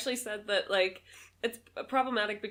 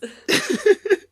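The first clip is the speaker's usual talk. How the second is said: loud laugh; 12 semitones higher, 13 dB louder than usual. Real, not acted. Burst of laughter.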